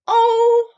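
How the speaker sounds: high, loud strained oh. Acted. Pooh being pulled hard from the hole, high loud oh. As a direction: cry out under the pull. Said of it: "Pooh only said".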